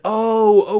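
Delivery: high, loud surprised oh; a sudden realizing oh; relieved realization. Retold or imitated. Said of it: "the customer said".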